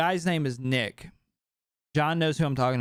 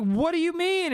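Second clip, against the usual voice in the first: much higher, startled disbelief; alarmed question. Retold or imitated. Retold reaction to roommate's news.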